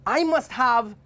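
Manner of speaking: storyteller's determined monkey; monkey character. A narrator's reading. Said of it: "he said".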